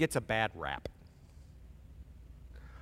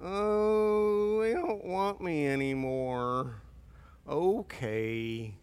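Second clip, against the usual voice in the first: slow, sulking mock pout; slow, pouting self-pity; mopey God; 3 dB quieter, much slower than usual. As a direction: play God as a hurt sulker, lightly. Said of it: "God's not ... going".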